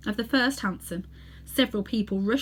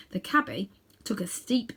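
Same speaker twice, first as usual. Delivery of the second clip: usual speaking voice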